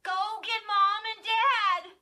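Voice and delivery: high, loud, slow urgent call; urgent order. A narrator's reading. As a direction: send her off fast.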